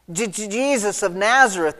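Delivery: nervous stutter on "Je-, Je-, Jesus", then the name. Acted. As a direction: stammer the first syllable of Jesus. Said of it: "I think they stutter and say".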